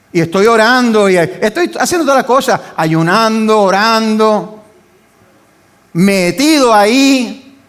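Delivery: mounting pulpit cry; fiery preaching. Acted. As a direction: preach it with fire. Preaching at full force.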